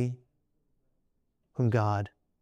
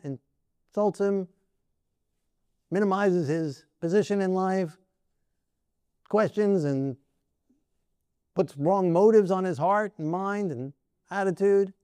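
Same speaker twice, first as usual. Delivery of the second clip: ordinary talk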